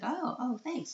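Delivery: high-pitched surprised thanks; pleasantly surprised. Retold or imitated. Driver handed chocolates by a parent, mock surprised thanks. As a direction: imitate someone caught off guard by a gift.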